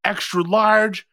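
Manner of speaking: high, slow, puffed-up ordering; proud, chesty. Retold or imitated. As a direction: announce it with your chest, very proudly. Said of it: "I said it with my chest. I was so proud".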